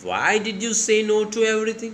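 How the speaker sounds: slow, high, gentle mother's question; teacher voicing a worried mother; mother asking. Shown as a demonstration. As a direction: read it as a caring mother, stretched out. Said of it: "She asked".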